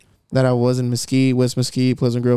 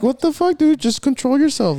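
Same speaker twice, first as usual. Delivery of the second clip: raised, exasperated scolding; angry retort; 10 semitones higher than usual. Retold or imitated. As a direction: snap at him to calm down. Retold outburst at rude guy.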